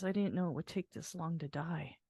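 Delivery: weak, faint voice. Retold or imitated. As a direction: speak it as if out of strength, half-imitating. Dying father's weak last words.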